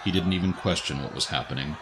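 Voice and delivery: usual speaking voice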